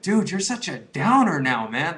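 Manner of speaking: mock-whiny friend griping; annoyed friends. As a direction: drag it out like an irritated buddy. Friends complaining about him.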